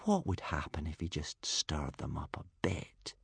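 low, soft whisper; whispered temptation. A narrator's reading. Toothless tempted to stir up the herd, whispering. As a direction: whisper it as a naughty secret thought. Narrator voices secret temptation.

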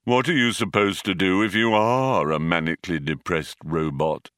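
gloomy, monotone robot voice; Marvin. A narrator's reading. Depressed robot turns the question on himself, funereal gloom. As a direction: intone it as if over a coffin. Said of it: "as if he was addressing a newly occupied coffin".

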